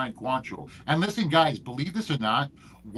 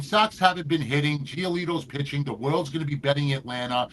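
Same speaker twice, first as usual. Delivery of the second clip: usual speaking voice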